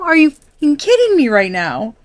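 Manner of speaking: angry repeat. Retold or imitated. Reader voicing a fed-up viewer, repeating it angrily. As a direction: say it again, still fuming. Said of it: "you are pissed".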